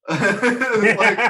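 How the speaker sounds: words pushed out through real laughter, slow; laughing while talking. Real, not acted. Asked about a favourite game, answering the host through laughter.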